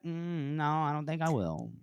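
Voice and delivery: hum, then coolly decline; mock contrarian refusal. Contrarian answers the crowd urging a kill, soft mock refusal.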